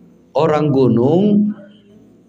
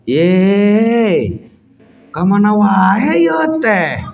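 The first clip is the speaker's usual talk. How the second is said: put-on Jampang accent; 7 semitones higher, much slower than usual. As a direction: drawl it out in the Jampang way. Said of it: "Logatnya begitu". (logat Jampang yang tinggi dan keras)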